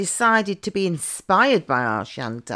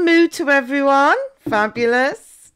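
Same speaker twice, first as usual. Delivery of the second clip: high delighted coo; 7 semitones higher, 4 dB louder than usual. Acted. Coaxing cow, then delighted.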